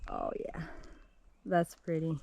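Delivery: usual speaking voice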